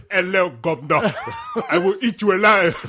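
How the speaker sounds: dictator attempting a British accent; mock African-accented British greeting turning into a loud threat; Idi Amin. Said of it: "Idi Amin trying to do a British accent".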